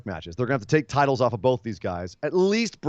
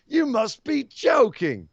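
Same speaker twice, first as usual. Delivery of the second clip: slow, higher British disbelief; slow mock incredulity; mock Pete Dunne; 9 semitones higher, much slower than usual. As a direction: drag it out, scornful and baffled. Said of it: "Pete Dunne".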